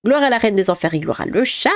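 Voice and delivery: ordinary talk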